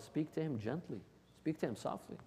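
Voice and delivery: soft, mild-voiced urging; gentle, soft. Shown as a demonstration. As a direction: drop the volume and say it gently.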